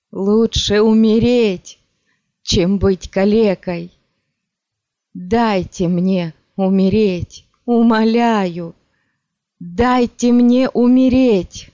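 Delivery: медленно, высоко и громко; слабеющая мольба под магией; замедленная мольба. A narrator's reading. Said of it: "раненый говорил всё медленнее". (всё медленнее, отчаянно)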